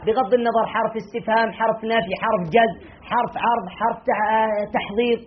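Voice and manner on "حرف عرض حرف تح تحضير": ordinary talk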